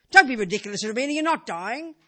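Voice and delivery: a loud, dismissive rebuke that starts hard; scolding retort. Retold or imitated. Sister scolding Rubina for talking of death, loud. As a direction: brush off her words like nonsense.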